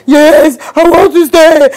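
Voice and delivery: put-on loud, high angry child voice; imitating a furious little girl, shouting back. Mother voices angry daughter.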